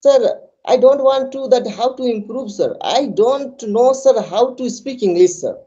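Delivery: a hint of a pleading student; mimicking whiny students. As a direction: plead like a helpless learner, whining sir. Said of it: "they say".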